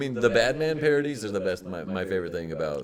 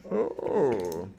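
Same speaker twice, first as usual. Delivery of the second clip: usual speaking voice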